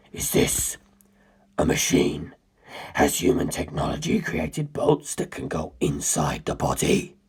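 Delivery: low, hushed, menacing whisper throughout; a cold ancient being confessing in a low whisper; ancient predator's whisper. Acted. Cars telling the narrator his history, low cold whisper. Carr examining the submarine.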